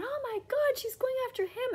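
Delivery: very high mock gasp; hammed-up falsetto outrage; mocking shocked fans. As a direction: parody the scandalised reactors. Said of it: "everybody was, like, freaking out".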